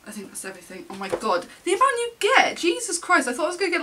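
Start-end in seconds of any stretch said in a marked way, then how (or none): 1.6-3.8 high, loud disbelief; astonished. Real, not acted. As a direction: exclaim it in amazed shock. Astonished by number of cards.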